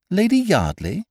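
very high, rising LADY YARDLEY; incredulous echo. Acted. Astonished echo of the name.

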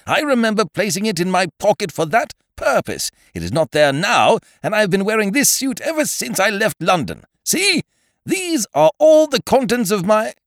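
high, loud indignant rush; high-pitched self-righteous ranting; heated insistence. A narrator's reading. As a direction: prove your point in rising agitation. Ukridge triumphantly proves his point.

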